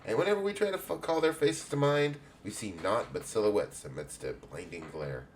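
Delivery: put-on lightly English-accented guildmistress greeting; a hint of a welcoming English matron. Guild head welcomes a young adventurer, light English accent. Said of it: "I'm making everybody have an English accent".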